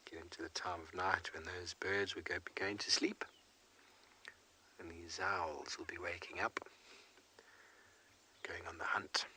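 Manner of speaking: hushed, breathy nighttime commentary; whispering. Real, not acted. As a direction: keep it to a whisper so the owls stay undisturbed. Hushed commentary near owls.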